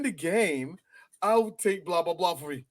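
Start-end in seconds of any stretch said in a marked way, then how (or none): none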